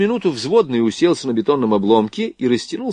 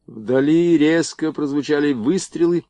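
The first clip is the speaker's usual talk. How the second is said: plain reading voice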